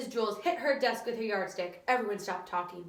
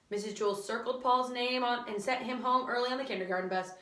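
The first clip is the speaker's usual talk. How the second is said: usual speaking voice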